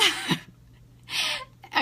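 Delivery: breaking into real laughter; real laughing fit mid-story. Real, not acted. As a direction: laugh helplessly partway through the words. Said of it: "I'm laughing about it now".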